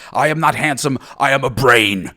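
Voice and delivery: half-shouted retort to mother; loud protest. Retold or imitated. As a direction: protest firmly to your mother. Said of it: "I'm like".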